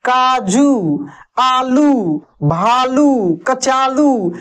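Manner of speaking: काजू, आलू, भालू, कचालू each ending in a long drawn ऊ; long u stretched. Shown as a demonstration. Teacher drills long u words for pupils, stretched. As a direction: stretch every final ऊ, slowly. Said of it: "इसमें खींच कर यानी लंबा समय लेता है".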